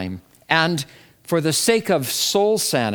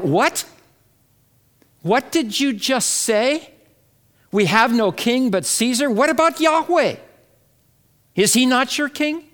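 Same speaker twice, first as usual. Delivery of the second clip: high-pitched, disbelieving questions; incredulous outcry; 8 semitones higher than usual. Acted. Preacher crying out at the chief priest's declaration, incredulous. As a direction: challenge them in rising, appalled disbelief. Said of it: "I want to cry out".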